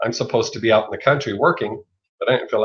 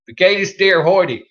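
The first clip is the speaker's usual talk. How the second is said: German in a heavy Southern drawl, higher and slow; Southern-accented German; 7 semitones higher, 4 dB louder than usual. Shown as a demonstration. Mocking own old Southern-accented German for viewers, laughing Southern drawl. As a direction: say "Wie geht es dir heute" slowly with a thick Southern twang, amused. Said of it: "with a very heavy Southern accent".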